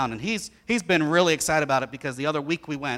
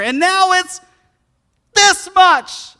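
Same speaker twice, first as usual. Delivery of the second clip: much higher, louder squeal of delight; squealing kid; 14 dB louder, much slower than usual. Retold or imitated. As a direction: squeal like a kid who found a bargain. Son reading price tag, thrilled.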